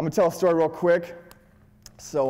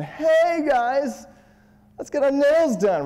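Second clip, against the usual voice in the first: much higher, louder girly mock voice; mocking guru opener; 12 semitones higher, 5 dB louder, slower than usual. Mocking a guru's opener to a group of girls, high camp voice. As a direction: camp it up like a fluffy pickup guru. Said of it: "your typical, uh, guru".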